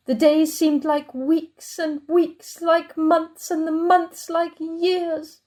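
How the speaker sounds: very slow, each phrase stretched; weary drawn-out telling. Acted. Chair recalling endless days shut away, weary and slow. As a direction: draw it out like endless waiting.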